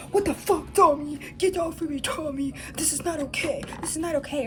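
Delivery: high-pitched imitation of an angry outburst; mock losing it. Woman mimicking a man freaking out at Tommy, mock outrage. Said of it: "and he loses it".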